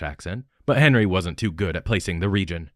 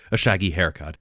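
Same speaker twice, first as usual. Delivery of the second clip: ordinary delivery, nothing marked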